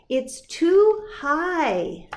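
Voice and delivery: slow, high whiny give-up; child's slow whine; giving up. Acted. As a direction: whine it like a defeated kid. Girl gives up on climbing.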